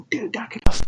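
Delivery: high, squeaky retold scolding; strained high-pitched mock rebuke; mock telling-off. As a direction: imitate an embarrassed telling-off.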